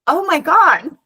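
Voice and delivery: amazed. Real, not acted. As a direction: gasp it in disbelief. Amazed reaction to boy.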